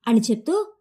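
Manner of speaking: plain reading voice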